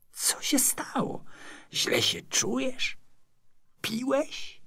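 high, slow, puzzled questioning; concerned young neighbour. A narrator's reading. Neighbour checks on fallen friend, high puzzled questions. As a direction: ask in a high, drawn-out youthful voice.

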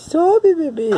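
high-pitched coaxing to a little one. Real, not acted. Parent urging the baby to climb, high coaxing baby talk.

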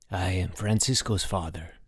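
lightly voiced formal diplomat, low-key and soft; dignified ambassador. A narrator's reading. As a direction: introduce yourself with a touch of solemn dignity.

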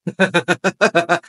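short burst of chuckling; laugh. Real, not acted. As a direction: laugh briefly after a silence. Laughs after a long pause.

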